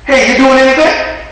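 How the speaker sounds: a lightly voiced eager caller; caller's voice. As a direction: chirp it out like an excited friend on the phone.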